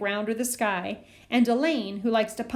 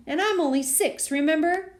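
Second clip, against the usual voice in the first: high whiny reminder; 6 semitones higher than usual. A narrator's reading. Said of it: "Daniel whined".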